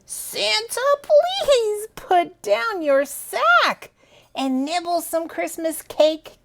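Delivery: put-on high, slow childlike pleading; child's note. Children's note to Santa, high and sweet. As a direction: read the note like an eager child. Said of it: "a note saying".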